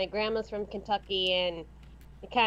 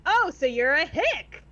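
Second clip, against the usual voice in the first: louder, higher, slowed mock realization; blind-date guy; 4 semitones higher, 4 dB louder, slower than usual. Voice actor quoting the blind date, mock realization. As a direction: act out the date's blunt verdict. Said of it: "the guy that I had voiced".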